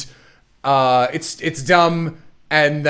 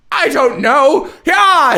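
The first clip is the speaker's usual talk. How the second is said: much higher, louder, whooping; mock gleeful villain; 9 semitones higher, 4 dB louder, slower than usual. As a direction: throw it out with silly glee. Villain shrugging then cheering.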